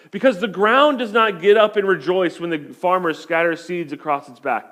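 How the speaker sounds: usual speaking voice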